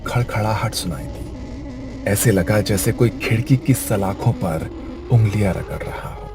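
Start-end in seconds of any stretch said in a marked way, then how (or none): none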